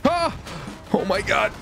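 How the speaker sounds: ordinary delivery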